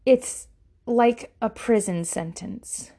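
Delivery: ordinary delivery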